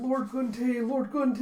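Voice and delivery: retold worried calling. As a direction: call after him anxiously across the field. Calling out during duel.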